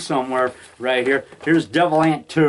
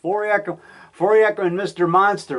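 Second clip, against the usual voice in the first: usual speaking voice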